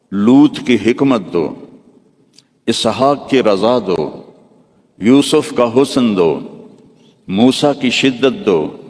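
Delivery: slow, measured litany with each دو landing firmly; preaching litany. Acted. Preacher listing each prophet's gift in a slow, solemn litany. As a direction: build a solemn chant of blessings. Rhythmic list of prophets' gifts.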